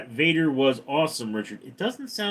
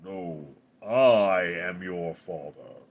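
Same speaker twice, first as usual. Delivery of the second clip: slow Darth Vader voice, lower; deep Vader voice; 6 semitones lower, slower than usual. Pretend. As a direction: intone it slow and low like Vader. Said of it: "deep James Earl Jones voice".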